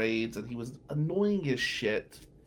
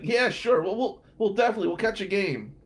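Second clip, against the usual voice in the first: fake enthusiasm; 7 semitones higher, 4 dB louder than usual. I'm being nice.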